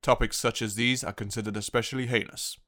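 low, solemn TV crime announcer; announcer voice-over. Acted. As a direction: intone it like a cop-show opener.

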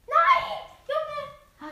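sudden high loud cry; shout of dismay. Real, not acted. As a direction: yell out in shock at the loss. Fund geht verloren, Aufschrei. (lauter entsetzter Aufschrei)

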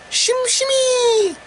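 very high, slow squeal; silly high-pitched shimmy; falsetto squeak. Pretend.

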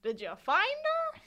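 high, singsong Swedish fireman voice. Retold or imitated. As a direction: call out high and drawn out, imitating a Swedish fireman. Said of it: "the Swedish fireman comes up and is like".

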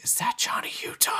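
hushed bystander's whisper, played for laughs; mock whisper. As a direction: whisper it softly like a stunned onlooker. Imagined bystander on the ground recognizing robber.

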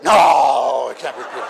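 loud, scoffing no; disbelieving shout. Retold or imitated. Praying church refusing to believe Rhoda, loud scoff.

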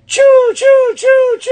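very high, loud choo choo; toy blaster noises. Retold or imitated. Daughter playing Transformers, high loud shooting sounds. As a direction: fire pretend shots with your voice. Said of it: "like, she goes".

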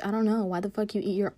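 ordinary delivery

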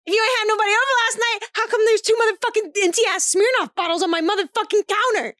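high, rapid retold tirade; zero-chill confrontation. Mom confronting her son about bottles, retold with zero chill. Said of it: "Like, zero chill".

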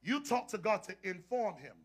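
soft sermon in spaced-out phrases; hushed preaching. Real, not acted. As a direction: bring it down quiet and pause between thoughts.

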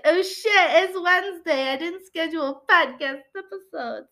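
sudden high alarmed "oh shit"; panicked realization. Retold or imitated. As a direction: blurt it out in mock panic. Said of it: "and then I was like".

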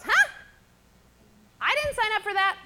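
high, loud outraged disbelief; mock indignant Hagar. Hagar balking at Sarah's order, high and indignant. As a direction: squawk it out like a put-upon servant. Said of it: "And Hagar goes".

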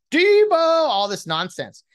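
high, loud, slow announcer-style yell; drawn-out mock announcer shout; mock hype call. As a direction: ham up the broadcast call. Mocking the famous broadcast call.

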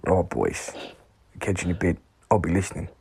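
put-on whispered Australian accent; early-morning Australian whisper. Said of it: "impersonate Aussie Dave when he leaves a voice note".

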